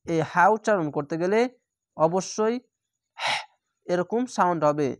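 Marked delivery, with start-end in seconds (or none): none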